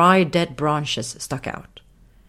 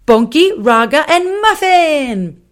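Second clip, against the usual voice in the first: very high, loud lion, slow on Muffin; delighted little lion; 11 semitones higher, 9 dB louder, slower than usual. Acted. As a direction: sing out the names triumphantly. Raga lists names.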